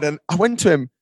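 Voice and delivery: normal talking voice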